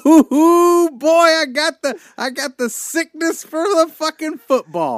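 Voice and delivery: hammed-up fever.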